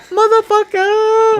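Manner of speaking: high, slow stretched yell; drawn-out catchphrase. Retold or imitated. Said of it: "just saying it like that".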